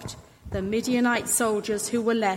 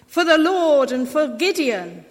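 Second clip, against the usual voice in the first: raised, loud rallying cry; battle cry; 5 semitones higher, 7 dB louder than usual. A narrator's reading. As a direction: shout it like soldiers charging. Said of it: "and shouted".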